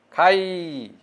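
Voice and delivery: খাই stretched, its final ই held; drawn-out ending. Shown as a demonstration. Teacher showing what sound ends the verb, খাই drawn out. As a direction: hold the end of খাই long. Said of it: "খাই উচ্চারণ করলে কি হচ্ছে".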